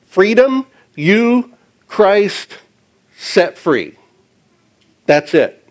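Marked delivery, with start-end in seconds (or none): 0.0-3.9 each word set apart, "freedom" and "you" pitched higher. Shown as a demonstration. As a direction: lay out the four Greek words one at a time with pauses. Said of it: "those four words are simply".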